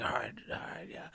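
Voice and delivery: half-whispered sleepy agreement; groggy. Retold or imitated. Friend woken at night agrees to go, groggy. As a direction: mumble it softly, barely awake. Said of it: "I'm dead asleep".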